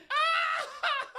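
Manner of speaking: shrieking laughing-crying "Ah!" very high; shocked yelp. Retold or imitated. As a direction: let out a sudden high shriek half laugh half cry. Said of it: "just goes".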